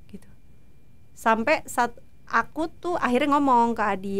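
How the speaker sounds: ordinary delivery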